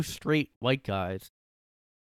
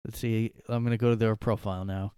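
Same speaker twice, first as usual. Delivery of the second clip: ordinary talk, nothing marked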